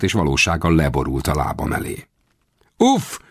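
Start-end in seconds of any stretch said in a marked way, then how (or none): 2.8-3.2 high-pitched indignant grunt, narrated; angry exclamation. A narrator's reading. Toppled guard to the man who disarmed him, angry cry. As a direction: cry out angrily on a much higher pitch. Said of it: "kiáltotta dühösen".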